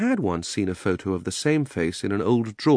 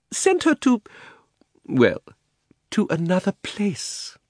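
evasive; 12 semitones higher, slower than usual. Acted. Uncle evasive.

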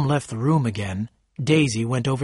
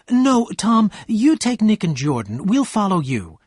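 high, raised, insistent voice; insistent refusal; 8 semitones higher, 3 dB louder than usual. A narrator's reading. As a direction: refuse firmly, then give directions. Wife refuses, directs husband to other car.

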